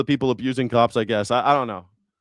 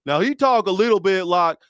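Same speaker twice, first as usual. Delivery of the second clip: higher mock Southern twang; ex-father-in-law's Virginia accent; 4 semitones higher, 4 dB louder than usual. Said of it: "that was his accent".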